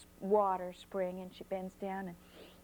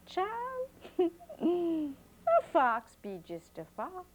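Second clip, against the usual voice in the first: chuckling grandma; 8 semitones higher, 3 dB louder, much slower than usual. A narrator's reading.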